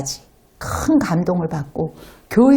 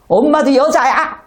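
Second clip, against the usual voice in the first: retorting mom; 5 semitones higher, 4 dB louder than usual. Retold or imitated. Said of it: "하면서 덤빈 적도 있었대요".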